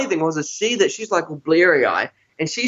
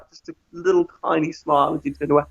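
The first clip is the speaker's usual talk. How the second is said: ordinary delivery, nothing marked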